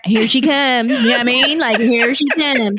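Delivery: stretched-out drawl accent; put-on drawl. Shown as a demonstration. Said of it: "Like, you have a draw".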